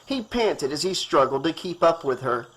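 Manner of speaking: ordinary delivery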